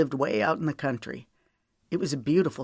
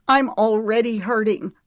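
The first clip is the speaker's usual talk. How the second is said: raised, louder pained outburst; hurting heroine, higher and louder than the narration; hoarse, hurting; 6 semitones higher, 7 dB louder than usual. A narrator's reading. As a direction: voice the hurt, high and pressed. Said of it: "she said hoarsely".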